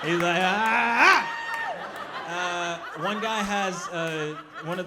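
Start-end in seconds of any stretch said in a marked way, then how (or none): 0.4-2.9 loud strained "ah" rising in pitch; mock groan of huge effort; straining cry. Man struggles to move a rock, straining cry.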